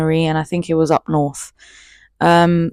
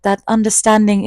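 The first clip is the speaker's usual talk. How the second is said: ordinary talk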